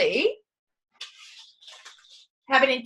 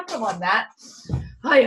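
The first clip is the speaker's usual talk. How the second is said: usual speaking voice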